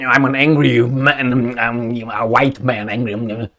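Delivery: mock angry-man mumble, slow; Mark Milley. Mocking a US official, slow mumbling parody of anger. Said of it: "remember Mark Milley?".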